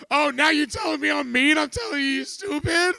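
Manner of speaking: high, loud, accusing yell; indignant shout. Retold or imitated. Man reenacting a screaming fight with his girlfriend. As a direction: throw her words back at her.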